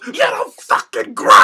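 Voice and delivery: loud, slow angry boss bark; imitating Vince, a slow furious shout; Vince McMahon shout. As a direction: bellow it at the wrestler like an outraged boss. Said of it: "the Vince McMahon voice".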